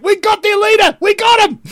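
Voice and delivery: very high, loud squeal of victory; triumphant aliens. Retold or imitated. Aliens flying off with the TV, triumphant squeal.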